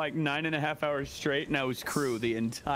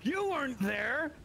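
higher, mock-accusing retold question; lightly voiced accusation; accusing herself; 10 semitones higher, slower than usual. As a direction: play the accuser, half-laughing. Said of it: "I was like".

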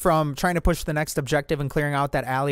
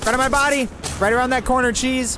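urgent callout; 9 semitones higher than usual. Real, not acted. Enemy on top of him.

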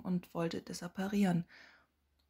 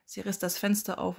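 usual speaking voice

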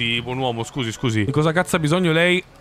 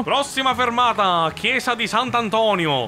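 higher, called-out stop announcement; bus announcer voice; 6 semitones higher than usual. Pretend. Driver announcing the church stop, mock announcer voice. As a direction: announce the stop in a raised pitch.

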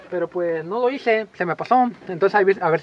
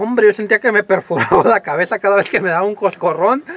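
usual speaking voice

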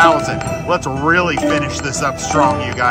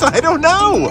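laughing; 13 semitones higher, slower than usual. Real, not acted. Laughing after asking about a bonus.

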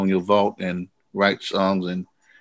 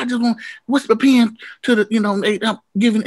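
James quoted; 13 semitones higher than usual. Retold or imitated. James voiced in a retold story.

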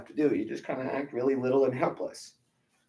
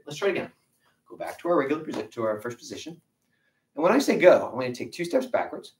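ordinary delivery

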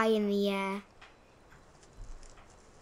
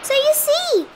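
high, loud triumphant rabbit; bragging; 8 dB louder than usual. A narrator's reading. Rabbit claiming victory over the bear, loud high brag. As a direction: crow it proudly. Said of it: "bragged the rabbit".

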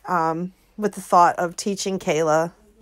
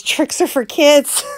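ad slogan; 6 semitones higher, 7 dB louder, slower than usual. Retold or imitated. Quoting the Trix ad slogan.

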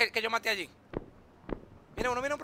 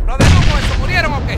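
loud; 4 semitones higher, 11 dB louder than usual. Real, not acted. Loud question during game.

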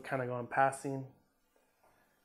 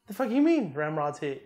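indignant; 3 semitones higher, 8 dB louder than usual. Retold or imitated. Indignant disbelief.

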